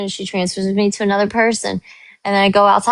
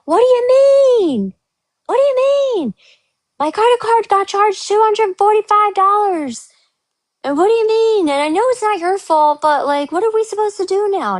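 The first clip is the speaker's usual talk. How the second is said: much higher, indignant whine; upset guest; 12 semitones higher than usual. Retold or imitated. Guest at the desk, shrill indignant complaint. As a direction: mimic an outraged hotel guest. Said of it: "and they're like".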